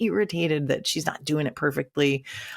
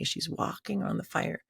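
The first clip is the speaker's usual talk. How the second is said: normal talking voice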